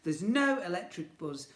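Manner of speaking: ordinary delivery, nothing marked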